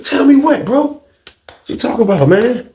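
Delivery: high, impatient demand; insistent questioning. Retold or imitated. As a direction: press the roommate for answers. Presses roommate for secret.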